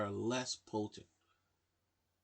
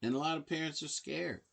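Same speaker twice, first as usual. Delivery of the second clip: ordinary talk, nothing marked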